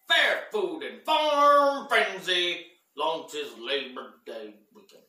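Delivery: headline read, drawn out; news anchor voice. Acted. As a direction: announce it like breaking news.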